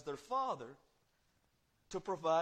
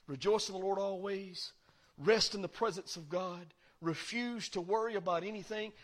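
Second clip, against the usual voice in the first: normal talking voice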